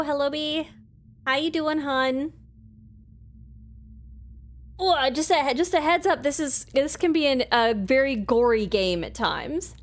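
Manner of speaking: ordinary delivery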